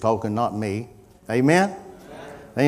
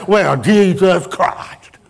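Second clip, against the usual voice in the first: slow, drawn-out mock curse; flippant oath; 5 semitones higher, 5 dB louder, much slower than usual. Preacher mimicking people swearing when things go wrong, flippant oath. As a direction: toss the name out as a slow, exasperated swear. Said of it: "flip out there whenever things is going wrong".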